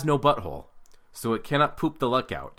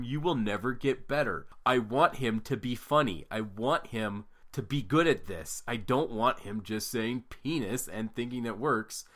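normal talking voice

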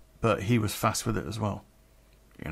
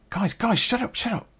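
hushing the room; 10 semitones higher than usual. Retold or imitated. Man on the phone to his mates, hushing them. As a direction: call out to quieten a noisy party.

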